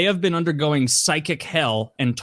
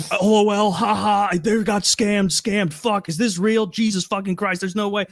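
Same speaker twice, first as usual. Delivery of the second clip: higher-pitched mocking crowd voice; jeering chat viewers; 6 semitones higher than usual. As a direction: rattle off viewers' mock-shocked reactions. Voices twenty thousand jeering online viewers.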